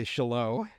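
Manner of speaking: shallot as shuh-LOH, final t dropped; French-style pronunciation. Shown as a demonstration. Cook admits a habit, shallot as French-style shuh-LOH. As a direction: drop the t and stress the end of shallot. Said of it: "dropping certain words 'cause I feel like they're French".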